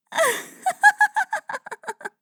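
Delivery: high, loud gleeful laughter; loud gleeful cackle; delighted laugh. Acted. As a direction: cackle with delight at his reaction. Delighted at his embarrassment.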